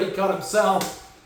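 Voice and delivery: usual speaking voice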